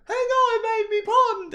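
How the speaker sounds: high, slow hammed-up Brit; mock British accent. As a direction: drawl it out as a silly Brit. Quoting Amy Pond in the woods.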